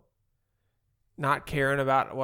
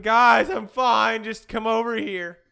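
screaming; 5 semitones higher, 6 dB louder than usual. Retold or imitated. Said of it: "And I'm screaming".

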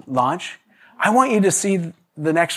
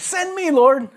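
high, ardent cry to God; fervent plea; 12 semitones higher, slower than usual. Retold or imitated. Old worship song singer pleading to God, high and fervent. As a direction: lift it up like a devoted worshipper.

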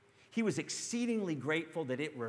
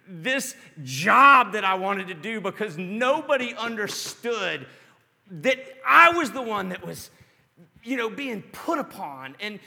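louder and higher, building into frustrated ranting; rising frustration; 5 semitones higher, 7 dB louder than usual. Retold or imitated. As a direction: let the frustration pile up and boil over. Preacher ramps up retelling anger.

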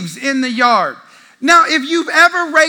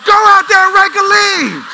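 high, loud outburst; exasperated dad yell; 8 semitones higher, 6 dB louder than usual. Retold or imitated. Dad to whining sons about the leaves, exasperated yell.

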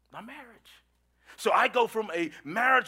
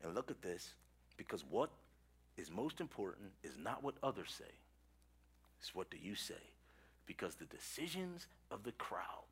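low, very soft, earnest sermon delivery; quiet, weighty address to the congregation; hushed preaching; 12 semitones lower, 17 dB quieter than usual. Real, not acted. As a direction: drop right down to let the tension settle.

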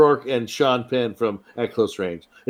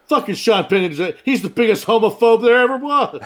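mock Mickey Rourke rant; 9 semitones higher, 5 dB louder than usual. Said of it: "Mickey Rourke was like".

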